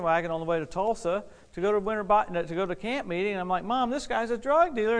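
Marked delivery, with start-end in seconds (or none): 3.6-5.0 higher-pitched son objecting to mom; retold worried protest; alarmed protest. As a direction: warn mom in a raised pitch. Son protesting to his mother.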